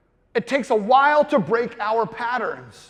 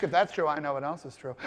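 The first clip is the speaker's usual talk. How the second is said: knowing low voice; 9 semitones lower, 9 dB quieter, faster than usual. Retold or imitated. Mocking the snap judgments.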